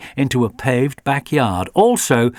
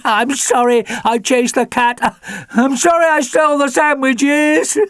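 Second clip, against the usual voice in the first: high, louder desperate dog voice; pleading dog; 14 semitones higher, 4 dB louder than usual. A narrator's reading. Alfie pleads with his family through the van door, high pleading dog. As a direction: apologise frantically to be let out.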